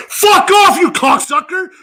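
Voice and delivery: sudden high, loud yell; mock-furious outburst. Fan cursing his favorite Avenger, mock-furious shout. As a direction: explode in hammed-up outrage. Mock rant at a film star.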